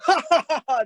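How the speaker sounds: high, loud ha ha ha; powerful laugh. Shown as a demonstration. Teacher shows how a laugh projects the voice. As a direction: laugh out from the chest. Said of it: "when you guys, like, laugh".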